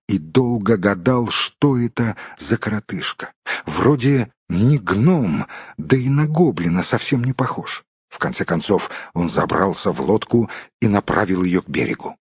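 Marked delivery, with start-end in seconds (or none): none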